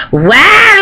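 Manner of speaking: ordinary talk, nothing marked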